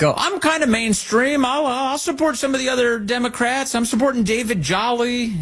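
put-on high-pitched mimicry; mock politician. Host mimicking candidate backing away from socialism, mock high voice. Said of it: "trying to dance a little bit away".

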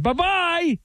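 high, held-out bye-bye; singsong farewell. Real, not acted. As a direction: send the caller off in a high singsong. Host signs off the caller.

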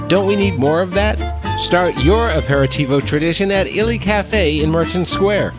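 ordinary delivery

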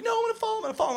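put-on tiny high-pitched kid voice; whining scared kid. Kid who doesn't want to skate, high anxious whine. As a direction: squeak it like a reluctant child.

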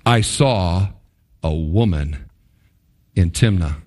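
very low "I saw ... a woman", broken by pauses; pointed emphasis. Shown as a demonstration. Preacher replays Samson's report to his parents, pointedly emphasized. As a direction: lean on "I saw", then drop "a woman" low and separate. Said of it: "Note the emphasis there".

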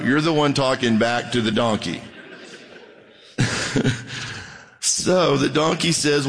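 ordinary delivery

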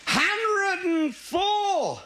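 put-on slow, high drunken drawl; slow high drunk reading; drunken slur. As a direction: stretch it out like a drunk squinting at a stone. Drunk reads tombstone.

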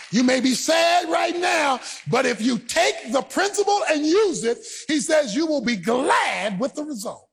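forceful, high-pitched sermon delivery; impassioned preacher driving the point home; preaching. Real, not acted. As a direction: preach it hard to the congregation, stretching key phrases.

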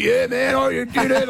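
high-pitched mock celebration; hammed-up cheery congratulations; up and about. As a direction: imitate an upbeat teammate, pitched well up. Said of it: "He's up and about. He's, like, smiling".

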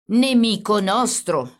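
officer losing his temper; angry. A narrator's reading. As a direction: spit it out slow and cross. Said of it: "spiegò il carabiniere adirandosi".

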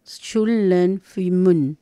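"Shum lei fui mun" spoken very slowly, one syllable at a time; slow careful demo. Shown as a demonstration. Teacher says the plural greeting slowly so listeners can repeat it. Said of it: "Como ahí ustedes pueden ver por el sonido".

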